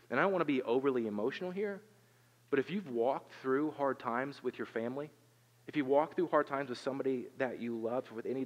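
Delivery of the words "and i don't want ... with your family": usual speaking voice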